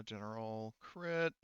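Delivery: ordinary talk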